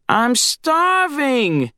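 high, slow, reedy crow squawk; Screech reedy squawk. A narrator's reading. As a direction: squawk it like a tiny hungry crow. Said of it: "His voice was a reedy squawk".

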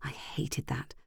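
unvoiced bitter whisper; whispered aside. A narrator's reading. Narrator muttering about Fran in the t-shirt, whispered. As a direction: breathe it out, resentful.

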